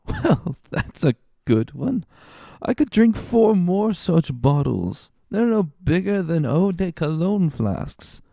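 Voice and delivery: put-on drunk man. Tipsy boasting over wine.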